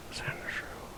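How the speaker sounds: breathy whispered "Sandshrew". Shown as a demonstration. Player musing on a nickname, whispered. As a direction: whisper the name to yourself.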